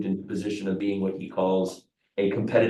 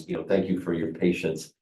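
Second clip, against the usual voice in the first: usual speaking voice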